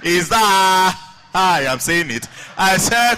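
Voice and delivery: loud mock protest with halts; stammering teacher. Teacher corrects the class, loud mock exasperation. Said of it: "he's a conch stammerer".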